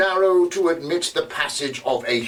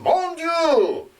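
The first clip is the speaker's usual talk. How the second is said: much higher French exclamation; gruff Frenchman; 13 semitones higher than usual. Retold or imitated. Retelling what the tailor heard, French exclamation. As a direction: cry out in French like a startled man. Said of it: "He, he heard distinctly".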